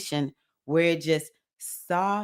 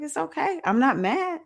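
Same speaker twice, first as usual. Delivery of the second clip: sweetly dismissive; 10 semitones higher than usual. Retold or imitated. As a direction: brush her off nicely, sing-song and light. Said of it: "I was just like".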